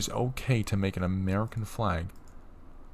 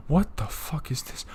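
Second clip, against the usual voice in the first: outraged whisper; 6 semitones higher than usual. Real, not acted. Outraged whisper at flag images.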